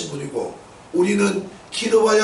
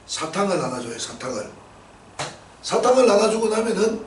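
ordinary talk

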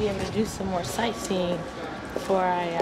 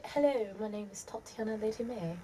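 softer imitated English accent; attempted English accent; 3 semitones higher, 7 dB quieter than usual. Shown as a demonstration. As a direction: introduce yourself in a put-on English accent. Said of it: "Can you do an English accent?".

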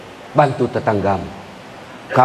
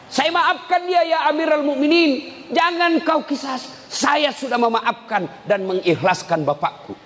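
put-on young man's plea; 12 semitones higher, 5 dB quieter than usual. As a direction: beg the caliph earnestly, voice raised high. Young man voiced pleading to Umar.

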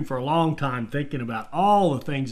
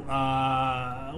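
ordinary talk, nothing marked

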